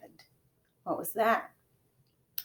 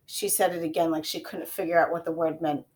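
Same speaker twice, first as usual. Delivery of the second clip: plain reading voice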